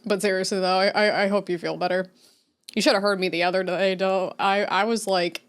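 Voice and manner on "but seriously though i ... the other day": ordinary talk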